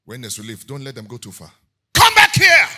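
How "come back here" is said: high, very loud call; commanding shout. Acted. Preacher orders the fleeing enemy back, commanding shout. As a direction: shout after someone retreating.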